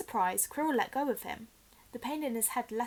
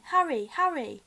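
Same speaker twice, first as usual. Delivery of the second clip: loud higher call, fading; distant voices calling; 5 semitones higher, 7 dB louder than usual. Acted. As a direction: cry his name from far away.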